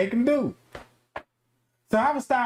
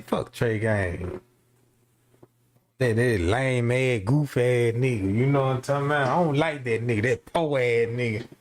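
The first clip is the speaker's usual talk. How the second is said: rival trash talk; 8 semitones lower than usual. Retold or imitated. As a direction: drag the insult out low.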